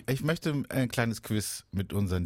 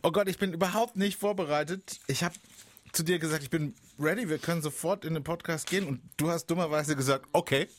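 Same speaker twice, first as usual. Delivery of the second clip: flustered; 7 semitones higher than usual. Real, not acted. Flustered, admits being unprepared.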